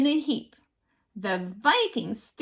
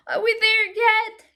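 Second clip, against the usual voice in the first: put-on whiny child; 9 semitones higher, 4 dB louder than usual. A narrator's reading. Lily tired on the climb.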